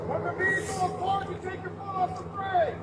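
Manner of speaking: distant high commands; loudspeaker commands. Real, not acted. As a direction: call it out across the street. Felony stop commands from afar.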